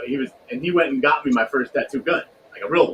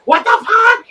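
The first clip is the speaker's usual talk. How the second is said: much higher, louder shocked shriek; imitated mom shriek; 9 dB louder, slower than usual. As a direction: shriek it in disbelief. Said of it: "And she's like".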